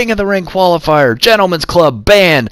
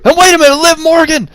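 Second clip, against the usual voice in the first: higher, loud sudden exclamation; surprised shout; 11 semitones higher, 3 dB louder, faster than usual. Real, not acted. Surprise run-in.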